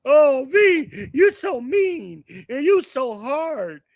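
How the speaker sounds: high, loud whiny mock complaint; loud, high mock-crying viewer voice; mocking whiny chat. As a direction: whine it like a hurt fan. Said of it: "some of you in the chat".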